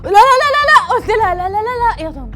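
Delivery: high, loud yelps of no; pained yelling. Retold or imitated. Bride refusing the aunt's earring, high pained yells. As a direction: cry out no in pain.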